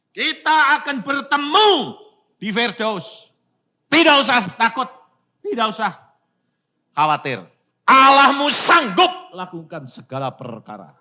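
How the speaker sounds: emphatic comfort. Retold or imitated. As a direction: assure her with fervour. Comforting dying daughter, retold. (meyakinkan anak dengan penuh semangat)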